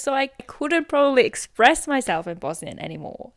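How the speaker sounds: ordinary talk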